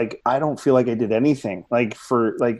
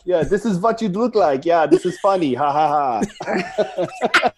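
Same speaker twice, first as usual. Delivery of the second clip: mocking laugh; 6 semitones higher, 3 dB louder than usual. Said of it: "He's like".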